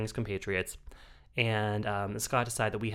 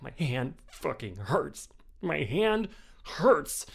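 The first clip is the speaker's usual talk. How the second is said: slow, dragged-out whine; drunken whining; 4 semitones higher, much slower than usual. Retold or imitated. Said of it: "I'm just like".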